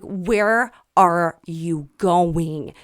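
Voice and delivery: loud, slow, drawn-out retold demand; alarmed demand. Calling after the neighbor who walks off, alarmed demand. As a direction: stretch each word out loudly. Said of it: "You called me freaking out".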